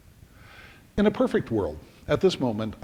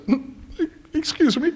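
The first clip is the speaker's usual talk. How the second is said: much higher, squeaky excuse me; nervous polite exit; 13 semitones higher than usual. Retold or imitated. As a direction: edge away politely in fear. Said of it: "And he says".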